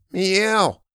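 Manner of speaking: high mewing meow; cat meow. Acted. Cat answering the family's call, high meow.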